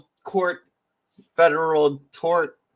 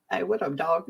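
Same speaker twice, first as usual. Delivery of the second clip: higher, softer buddy-style greeting; imitated casual slang greeting; 5 semitones higher, 5 dB quieter than usual. Greeting mom like a buddy, casually imitated. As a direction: lightly mimic a slangy hello. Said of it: "that's how I talk to my mom".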